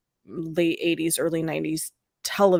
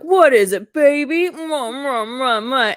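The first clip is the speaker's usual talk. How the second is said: louder, higher hammed-up answer; playful over-the-top imitation; mock reply with kisses; 7 semitones higher, 6 dB louder than usual. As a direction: answer the call, then smack out mock kisses. Acting out his reply and kisses.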